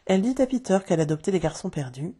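plain reading voice

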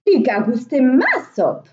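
loud storybook middle-bear voice; Mehdi Ours. A narrator's reading. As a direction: middle bear's loud exclamation. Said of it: "s'excria à son tour Mehdi Ours".

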